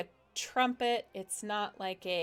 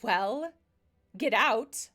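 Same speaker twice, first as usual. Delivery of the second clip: curt dismissal; 3 semitones higher, 7 dB louder, slower than usual. Acted. Genasi dismisses the party.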